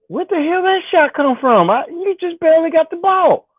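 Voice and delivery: can't believe what you just saw; high excited disbelief. Real, not acted. Reacting to game in disbelief.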